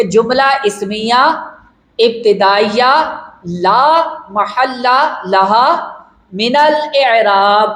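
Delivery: very slow, every term drawn out; slow dictation. Shown as a demonstration. Teacher giving the complete analysis to students, very slow. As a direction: spell out the complete label for students. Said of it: "جب اس طرح بولیں گے".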